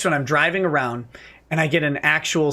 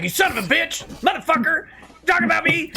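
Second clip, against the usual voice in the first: high mock cursing rant; mock screaming; 11 semitones higher than usual. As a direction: imitate a furious screaming rant. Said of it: "screaming profanity".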